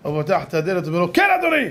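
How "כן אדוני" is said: abrupt, high-pitched "yes, sir?"; higher, challenging reply at the door; annoyed answer. Acted. Man answering a late-night knock, annoyed.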